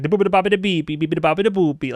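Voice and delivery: high, bouncy fake Italian babble in a cartoonish voice; hammed-up nonsense Italian, pitched high; silly made-up Italian sounds, said with a high lilt; mock Italian gibberish. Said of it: "that'd be super offensive".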